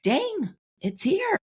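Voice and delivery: high-pitched mock wonder; acted happy amazement. Coach voicing someone whose wish has arrived, high acted amazement. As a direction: marvel at it, voice lifted way up.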